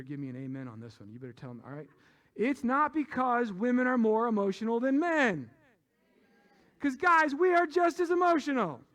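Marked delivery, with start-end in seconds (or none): 2.3-7.4 emphatic, raised preaching voice; loud proclamation. Real, not acted. Preacher winning an amen from the ladies, loud proclamation. 7.4-8.8 high, forceful shout to the crowd; loud proclamation. Real, not acted. Preacher telling the guys a home truth, loud proclamation.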